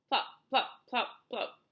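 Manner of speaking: loud, high, separated plops; punchy sound-effect plops; squelching impacts. A narrator's reading. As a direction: pop each word like a hit. Imitating hailstones hitting peach flesh.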